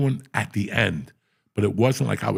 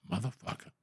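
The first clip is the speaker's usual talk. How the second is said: softly, shaking your head; quiet disbelieving mutter; 13 dB quieter than usual. Retold or imitated. Rogan's head-shaking reaction.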